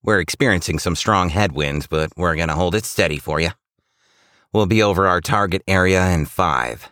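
lightly voiced pilot on the microphone; Rudolph. A narrator's reading. Rudolph updates the sleigh crew on the flight, lightly voiced pilot. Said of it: "says Rudolph into the microphone".

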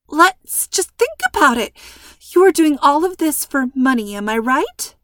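high, loud anxious coaxing; nervous persuading. A narrator's reading. Hostage coaxing the gunman, high and loud.

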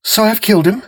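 high, stunned wife's voice; steadying herself after the killing; the wife talks herself calm; Mary to herself. Acted. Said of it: "she told herself".

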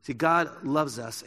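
ordinary talk

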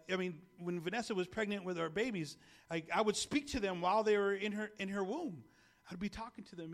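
ordinary talk

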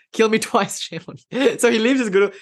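cracking up mid-joke; laughing. Real, not acted. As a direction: laugh through the punchline. Jokes and laughs through it.

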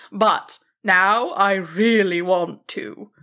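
big, kindly dragon voice; kind, loud dragon. A narrator's reading. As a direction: speak as a benevolent giant dragon. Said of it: "the dragon corrected kindly, if loudly".

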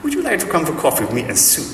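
high-pitched, badly faked German accent; [name]'s German accent. German woman inviting neighbour for coffee, mock German accent. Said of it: "I'm gonna stop now 'cause it isn't working".

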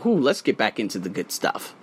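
ordinary talk

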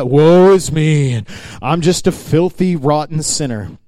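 drawn-out, loud self-pitying lament; mock woe-is-me. Preacher mimicking falsely humble people, woe-is-me. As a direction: wail it slowly with put-on self-pity. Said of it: "walk around with this "woe is me" attitude".

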